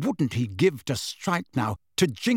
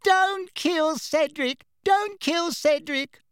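slow, very high mocking wail; mock whimper in a squeaky-high voice; high-pitched whimpering; slower than usual. A narrator's reading. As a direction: mimic a whimpering victim, high and drawn out. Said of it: "adopted a high-pitched, whimpering voice".

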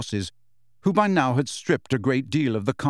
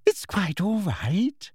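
high, slow, syrupy reassurance; condescending inspector's coo; falsely understanding; 7 semitones higher, slower than usual. A narrator's reading. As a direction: soothe with patronising sweetness. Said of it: "intended to be an understanding smile".

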